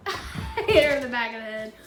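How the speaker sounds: said through real laughter; laughing after the ball hits the dog's head. Real, not acted. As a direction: laugh while you tell it. Laughing after hitting dog.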